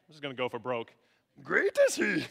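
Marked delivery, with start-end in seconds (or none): 1.4-2.3 higher, louder creature voice; Yoda. Acted. Preacher showing how Yoda would say it, Yoda voice. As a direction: go for broke on a comic alien impression. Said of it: "tempted to do the Yoda voice".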